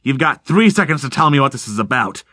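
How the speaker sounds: plain reading voice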